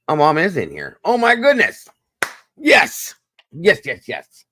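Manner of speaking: overjoyed to see her arrive; excited greeting. Real, not acted. Host spots mom in the chat, squeals with delight.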